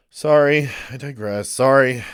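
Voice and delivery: loud, abrupt apology; loud sorry. Real, not acted. Loud apology after a rant.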